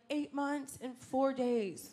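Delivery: drawn out, every word slow; slow proud parent. Retold or imitated. Comic quoting a new parent answering the baby's age, slowly. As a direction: savour each number like a proud parent. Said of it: "And they be like".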